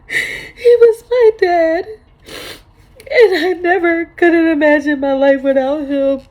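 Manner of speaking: real crying, high and loud, words breaking; ugly cry. Real, not acted. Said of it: "I'm giving you guys the ugly cry".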